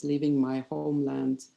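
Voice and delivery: ordinary talk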